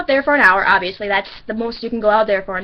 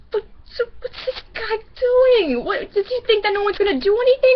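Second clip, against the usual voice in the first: alarmed friend; 10 semitones higher than usual. Retold or imitated. Shelby to teller, alarmed about the creep, high and teary. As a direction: pitch it up high with a teary catch.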